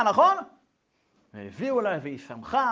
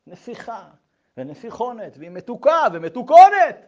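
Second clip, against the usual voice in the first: mock tearful mother; 2 semitones higher, 11 dB louder than usual. Mother protests her love to psychologist, mock tearful. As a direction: imitate a mother starting to cry. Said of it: "התחילה לבכות".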